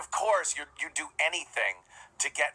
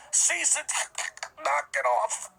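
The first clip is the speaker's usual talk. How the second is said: much higher mock voice for John's legal threat; 10 semitones higher than usual. Ranting host mocks John's legal letter, high squeaky voice. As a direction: squeak out John's cease and desist like a cartoon. Mocking John's cease and desist.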